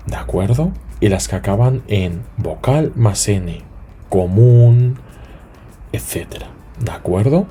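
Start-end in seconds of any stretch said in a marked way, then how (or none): none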